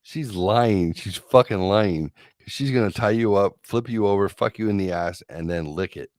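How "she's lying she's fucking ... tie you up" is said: ordinary talk, nothing marked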